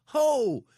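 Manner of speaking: loud, slow "Ho!" jolted shout; startled shout. Retold or imitated. Buddy looks up from his phone, startled shout. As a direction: yell like you just saw a car flying at you. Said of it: "and goes".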